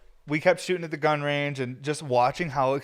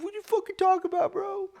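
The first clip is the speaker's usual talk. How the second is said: much higher, mocking incredulous squawk; mock gun expert. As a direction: parody a scoffing gun guy. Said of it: "you guys are gonna be like".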